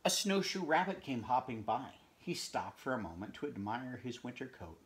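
ordinary delivery, nothing marked

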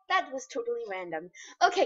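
ordinary talk, nothing marked

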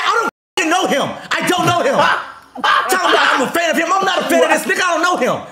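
high-pitched mock outrage; indignant denial. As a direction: imitate her protesting, pitched right up. Re-enacts the heated woman's denial.

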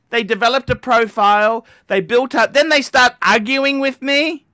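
usual speaking voice

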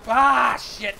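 very loud, high outburst, then a curse; startled yell. Real, not acted. Streamer caught out mid-game, startled loud yell. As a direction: burst out yelling, then swear.

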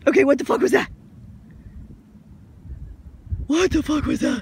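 loud, alarmed cry; scared loud shout. Real, not acted. Woman in the water shouts in fright at something unseen. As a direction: yell out in fright.

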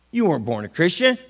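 ordinary talk, nothing marked

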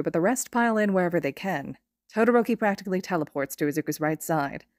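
ordinary delivery

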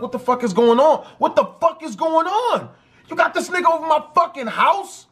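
high-pitched retold outrage, building; imitated angry confrontation, voice climbing; outraged boyfriend. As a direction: act out a boyfriend demanding answers.

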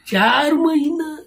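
high, drawn-out disbelief; slow, high-pitched incredulous question; incredulous echo. Real, not acted. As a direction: stretch it out in astonished protest. Disputes partner's claim of four months.

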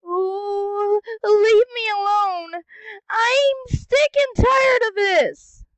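high, loud whiny protest; exasperated whine; fed-up wail. Acted. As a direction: cry out in frustration. Fed-up outburst.

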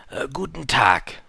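salesman's drawn-out hello; merchant. Acted. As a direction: draw the greeting out in a put-on voice. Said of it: "sagte der Händler".

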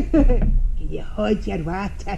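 slow, loud Glaswegian through a laugh; Glaswegian demonstration. Shown as a demonstration. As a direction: show the proper Glasgow accent, drawn out. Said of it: "can you say it for me".

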